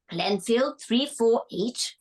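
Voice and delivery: put-on slow, old-fashioned posh English phone answer; posh dad on the phone. Dad answering the phone after clearing his throat, in old-fashioned posh English. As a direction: read the number out slowly in posh English. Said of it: "posh English accent".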